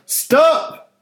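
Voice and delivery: very high, loud cry; shout. Acted. Francis seeing a stranger raise a bat at his car, loud high shout. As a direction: scream it to stop him. Man about to smash the car.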